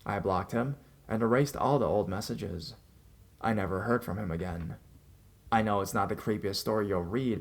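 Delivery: usual speaking voice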